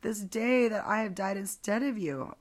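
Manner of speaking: high-pitched theatrical mourning; acted wailing lament. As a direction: cry out as a grieving mother, hammed up. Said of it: "So she's crying".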